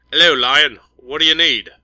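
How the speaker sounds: put-on clipped English accent greeting over the radio; proper English accent. A narrator's reading. English commander answering the ship's call, in a proper English accent. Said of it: "A proper English accent shot out of the speaker".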